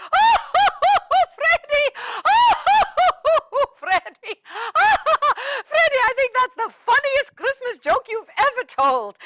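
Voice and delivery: high, loud laughing through the words; big laugh. Acted. Mrs Claus cracking up at Freddie's carrot joke.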